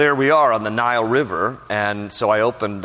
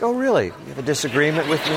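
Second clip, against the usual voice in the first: put-on pastor face; 3 semitones higher, faster than usual. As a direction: fake interest like a polite pastor. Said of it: "I put on a pastor face".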